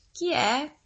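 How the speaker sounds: put-on high-pitched old granny calling out; high granny voice, questioning. A narrator's reading. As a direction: ask who's there as a storybook granny. Granny calls from her bed.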